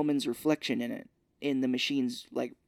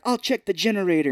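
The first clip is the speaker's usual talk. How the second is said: louder, higher announcement; imitated nurse; 7 semitones higher, 7 dB louder than usual. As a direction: call it out as the nurse. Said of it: "the nurse is like".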